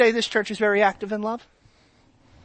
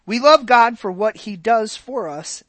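ordinary talk, nothing marked

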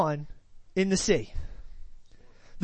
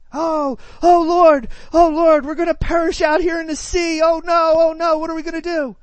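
high, loud, panicked wailing; panicked cry; 6 semitones higher, 8 dB louder than usual. Acted. Disciples panicking in the storm, loud frightened wail. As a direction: cry out like men sure they'll drown. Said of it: "recognizes they're panicking".

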